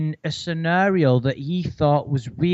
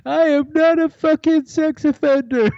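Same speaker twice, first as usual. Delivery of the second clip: much higher, louder denial through laughter; laughing mock Lorne; 12 semitones higher, 4 dB louder than usual. Co-host quotes Lorne's denial, high laughing mock voice. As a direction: protest it, cracking up. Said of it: "the bit where he goes".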